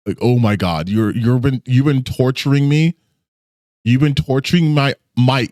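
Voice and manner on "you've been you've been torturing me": ordinary talk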